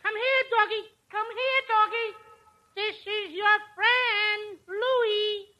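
coaxing a dog. Acted.

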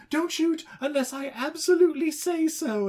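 high-pitched mock pleading order; urgent command. Owner telling police not to shoot, high urgent command. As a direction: call it out high and insistent.

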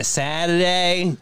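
"Saturday" drawn out slowly the Boston way; slow mock-Boston "Saturday"; Boston pronunciation. Shown as a demonstration. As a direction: stretch "Saturday" with Boston vowels. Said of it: "whenever they say".

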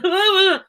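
high, loud mock blubber; imitated blubbering. Fan imagining meeting her favorite actress, mock blubbering. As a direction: burst into wordless, hammed-up blubbering. What she would have blubbered, starstruck.

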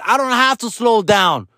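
high-pitched indignant woman's yell. Retold or imitated. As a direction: imitate an angry woman yelling from a car. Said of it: "She said".

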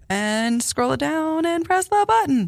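high, rising station-plug voice. Real, not acted. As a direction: pitch it up into a high radio-announcer finish. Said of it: "you pitch your voice up".

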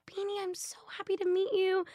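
put-on high, whispered Irish accent; a sweet, airy Irish greeting. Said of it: "in her adorable Irish accent".

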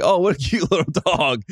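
high, laughing coo at a cute dog; mock baby-talk gush at a tiny terrier. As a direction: gush over a little puppy, half laughing. Fooled by sweet-looking dog.

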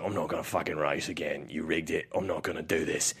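low, quiet monotone; an understated impression of a tough guy refusing; action-hero growl. Host voices Jensen Ames defying the warden, low action-hero growl.

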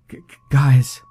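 high, stuttering with fear; frightened stammer. A narrator's reading. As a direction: stammer it out, terrified. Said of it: "Monica stammered".

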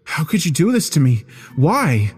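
high, hurt questioning; anguished plea. A narrator's reading. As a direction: ask it in high-pitched despair. Narrator pleads with departing stranger.

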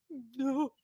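soft mock-tearful denial. Retelling girlfriend denying that she cries, mock tearful. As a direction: imitate denying it through tears, softly. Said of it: "she's crying, crying, crying".

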